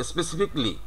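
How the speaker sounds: "specifically" as spesi-FIK-lee; "specifically" ending in "fik-li"; correct "specifically". Shown as a demonstration. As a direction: say "specifically" with the "ca" dropped. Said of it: "সঠিক উচ্চারণটা হচ্ছে".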